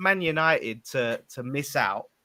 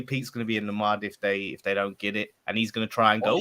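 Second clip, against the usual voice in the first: usual speaking voice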